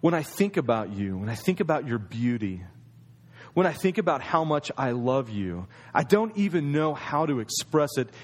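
husband telling his wife why he brought roses, tender and low; loving, tender. Acted. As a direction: speak it like a love letter. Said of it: "Because he loves you".

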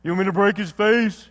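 mock mob enforcer taking orders; lightly hammed-up hitman, eager to oblige; Luca Brasi. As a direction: put on a hired heavy's voice. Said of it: "He's not Luca Brasi".

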